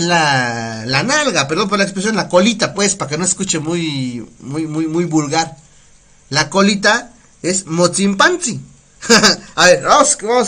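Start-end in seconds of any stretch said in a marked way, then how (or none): none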